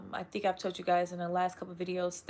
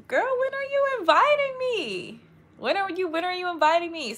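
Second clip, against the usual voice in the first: excited playful demand; 10 semitones higher, 8 dB louder than usual. Real, not acted. Playful demand to a London viewer.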